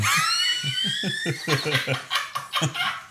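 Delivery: high-pitched real laugh; helpless high laughter. Real, not acted. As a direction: burst out in a long high laugh. Laughing at rerun joke.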